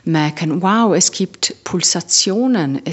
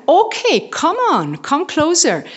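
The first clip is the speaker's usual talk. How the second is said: high, defiant come on; daring taunt; 9 semitones higher than usual. Pretend. Facing down an imaginary tiger, high defiant taunt. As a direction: taunt the fear to come closer.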